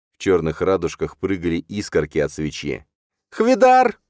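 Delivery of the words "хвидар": loud, very high yell; screamed call. A narrator's reading. Stepmother calling her husband for help, screaming. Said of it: "закричала она".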